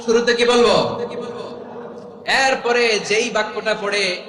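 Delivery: loud, high-pitched sermon delivery; full-force preaching. Real, not acted. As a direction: proclaim it to the whole crowd.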